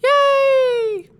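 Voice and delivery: very high, long loud cheer; shrieking high cheer, held long. Acted. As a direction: squeal a drawn-out cheer in a tiny character voice. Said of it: "someone that sounds enormously like [name]".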